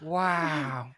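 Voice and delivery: lightly imitated drawn-out wonder; Jennifer Coolidge. Friend recalling last episode's impression, Jennifer Coolidge. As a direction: stretch the word in a light actress impression. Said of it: "I can do Jennifer Coolidge".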